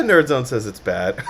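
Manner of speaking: laughing while talking; words breaking into a real laugh; through laughter. Real, not acted. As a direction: report the bad verdict while cracking up. Reports bad verdict while cracking up.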